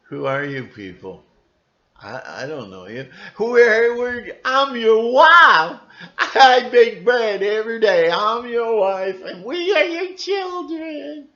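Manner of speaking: high, tearful mock wife and children voices; Job's bewildered family. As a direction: wail like a stranger claiming to be his wife. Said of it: "he's going".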